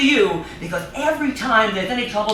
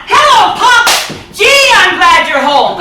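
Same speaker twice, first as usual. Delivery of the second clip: much higher, louder sing-song hello; cheerful greeting; 8 semitones higher, 7 dB louder than usual. Acted. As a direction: call out happily to Pop. Daughter greets father coming home.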